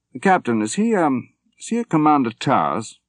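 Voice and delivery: put-on hearty old general. A narrator's reading. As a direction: play the cheerful old soldier who has made peace with dying. General voiced again.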